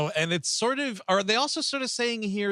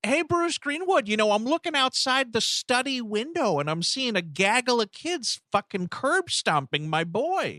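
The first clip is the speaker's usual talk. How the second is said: mock casual dad; 7 semitones higher than usual. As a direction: report it like an oblivious dad. Voicing the father calling out.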